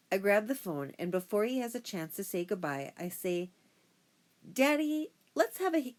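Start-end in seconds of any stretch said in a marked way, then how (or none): 4.5-5.9 put-on high, loud, excited child's request; eager pleading. A narrator's reading. Daughter to her dad before he says goodbye, eagerly pleading. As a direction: burst out with it, pitched way up. Girl eagerly proposes kissing contest.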